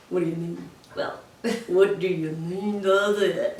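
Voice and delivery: soft, slow and dim; slow dopey voice. Shown as a demonstration. Agent voicing a slow-sounding puppet personality. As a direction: sound a bit slow-witted. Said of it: "personality seems a little slower".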